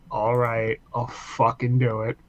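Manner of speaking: low, soft, heavy resignation; imitating a worn-out man giving in; resigned sigh. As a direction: give in wearily, low and quiet. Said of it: "he just sighs so heavily".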